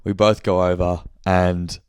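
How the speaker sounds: usual speaking voice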